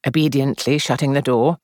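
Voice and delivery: plain reading voice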